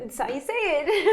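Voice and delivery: laughing. Real, not acted. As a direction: echo it while breaking into a laugh. Laughing at shared non-explanation.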